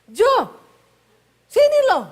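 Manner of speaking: shrill-high yell across the house; loud high Sulawesi call. Retold or imitated. Wife calling husband to come, loud high call. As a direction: bellow the name up high and sharp. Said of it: "Orang Sulawesi kalau ngomong nadanya tinggi terus".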